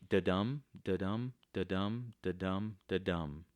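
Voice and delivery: "da-dum" with a light "da" and a punched "dum"; bouncing "da-dum" pulse, weight on each "dum"; unstressed-stressed beat. Shown as a demonstration. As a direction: chant "da-dum" in even iambic beats. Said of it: "So we always go unstressed, stressed".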